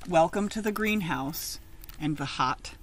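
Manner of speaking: lively picture-book reading; read-aloud story. Acted. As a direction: read it out to a class of children.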